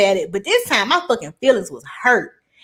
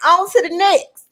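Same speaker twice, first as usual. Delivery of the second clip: dad's brush-off; 8 semitones higher than usual. Retold or imitated. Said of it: "My daddy was like".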